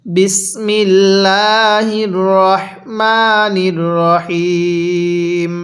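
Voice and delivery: drawn-out, chanted bismillah; slow recitation. Real, not acted. Teacher opens the Quran lesson with slow recitation. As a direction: recite it slowly and reverently.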